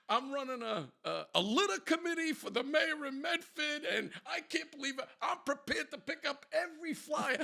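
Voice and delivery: high, loud mock begging; re-enacted pleading. Driver offering the cop a cleanup, pleading.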